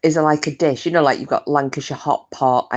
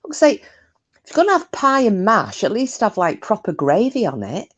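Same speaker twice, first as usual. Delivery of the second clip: retold exasperation; 7 semitones higher than usual. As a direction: vent the annoyance as the thought came. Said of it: "And I'm thinking".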